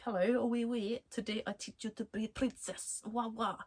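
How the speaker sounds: a sassy put-on French accent, softly; a playful French accent on a princess lesson; French cat accent. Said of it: "she's this little French cat".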